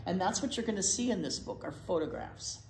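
ordinary talk

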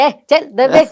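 loud, mock annoyed brush-off; playful fed-up retort; mock irritated snap. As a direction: shoo her off in fake irritation. Husband snaps at her teasing.

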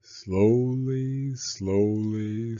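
ordinary delivery, nothing marked

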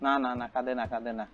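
put-on quiet the baby gently; soothing hush. Player gently hushing the crying game baby.

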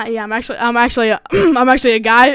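low, hesitant male reveal; dropping to own voice. Real, not acted. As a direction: drop the pitch and confess haltingly.

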